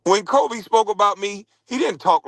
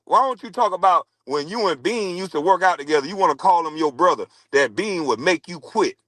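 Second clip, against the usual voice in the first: ordinary talk, nothing marked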